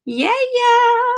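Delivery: high, delighted sing-song yeah yeah; excited yeah. Real, not acted. Reader delighted by Libra's card, high excited. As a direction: squeal it with joy at the card.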